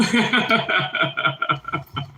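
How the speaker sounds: high, rapid giggle; long run of high chuckles; laugh. Real, not acted. Streamer amused by his own chapped lips, laughing.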